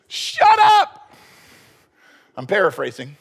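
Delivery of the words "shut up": very high, loud cut-off yell; sharp shout. Acted. Samuel cuts off Saul's excuses, high shouted command. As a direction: shout it to silence someone. Acts Samuel cutting Saul off.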